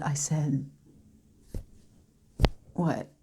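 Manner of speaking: very soft, halting recollection with long pauses; near-whispered dramatic monologue; grieving Lucy, hushed. Acted. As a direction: barely voice it, reliving the wait for the ambulance. Performs monologue as Lucy, partner dying.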